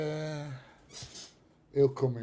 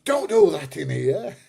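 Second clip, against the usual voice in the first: higher, louder, slow mock scolding; hammed-up wife's reprimand; 5 semitones higher, 9 dB louder than usual. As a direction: mimic the wife telling him off. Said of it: "Missus P J goes mad".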